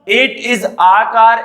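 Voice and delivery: slow example sentence. Shown as a demonstration. Teacher to children, dictating a very slow example. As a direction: stretch out It is a car word by word. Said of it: "कार का देखो".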